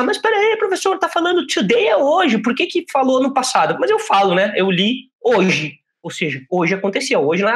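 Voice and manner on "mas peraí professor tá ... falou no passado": high, mock-puzzled student objection. Student objecting to the teacher, high and puzzled. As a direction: play the confused student asking the teacher, much higher. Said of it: "Algumas pessoas aí já podem pensar".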